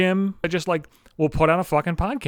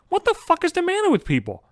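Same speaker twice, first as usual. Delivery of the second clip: much higher incredulous cry; exasperated outburst. Real, not acted. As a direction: throw up your hands and yell. Peak of outraged rant.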